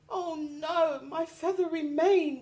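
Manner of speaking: high upset lion; roared. A narrator's reading. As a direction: cry out in dismay. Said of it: "roared the lion".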